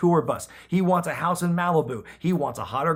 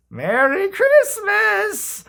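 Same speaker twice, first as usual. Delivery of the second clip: very high, very slow, louder put-on croak; squeaky old-lady cheer; 6 dB louder, much slower than usual. Mae Young left alone on the floor, squeaky drawn-out cheer. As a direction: stretch it out like a dazed old woman.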